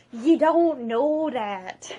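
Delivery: slow, lightly voiced Gobber. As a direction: counter the chief gently. Arguing as Gobber.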